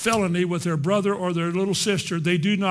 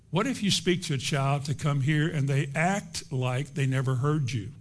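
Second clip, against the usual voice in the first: ordinary talk, nothing marked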